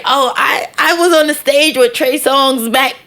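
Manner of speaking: boastful mock artist, slowing at the end; bragging artist voice. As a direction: brag like a wannabe name-dropper.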